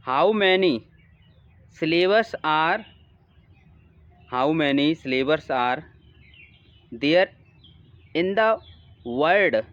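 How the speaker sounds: word-by-word exam question read-aloud; careful dictation pace; slow question reading. Acted. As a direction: read it out slowly so students can follow. Reads English question aloud slowly.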